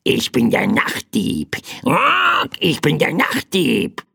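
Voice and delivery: croaking bird with rasping caws; krächzender Vogel. A narrator's reading. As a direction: croak it out like a crow. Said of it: "krächzte das kleine Federvieh". (krächzende Vogelstimme)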